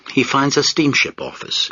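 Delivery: ordinary delivery, nothing marked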